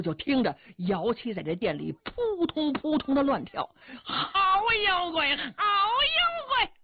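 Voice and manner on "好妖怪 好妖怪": high, slow, rising battle yells; fighting shouts. Acted. Yao Qi fighting the unseen monster, slow rising high shouts. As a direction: build the cry into a full stretched shout.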